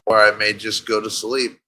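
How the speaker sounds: ordinary talk, nothing marked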